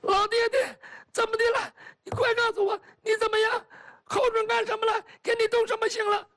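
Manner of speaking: high, urgent crying questions; anxious tearful pleading. Acted. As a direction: beg her father for answers through tears. Daughter anxiously questions her father. (焦急带哭腔的追问)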